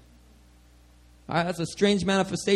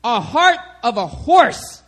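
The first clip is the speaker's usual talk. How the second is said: higher and louder, slowing on a heart of a horse; amazed villagers; 9 semitones higher, 6 dB louder than usual. Retold or imitated. Villagers telling the preacher what his tongues meant, amazed. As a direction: announce it like astonishing news. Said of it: "They were like".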